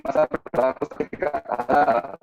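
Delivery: ordinary talk, nothing marked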